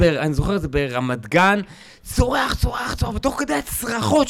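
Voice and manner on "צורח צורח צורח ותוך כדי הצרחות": retold screaming. Comedian retells a public fight, high mock screaming. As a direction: mimic screaming while telling the story. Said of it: "רבים צרחות".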